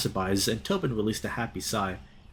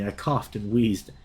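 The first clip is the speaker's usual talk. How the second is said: usual speaking voice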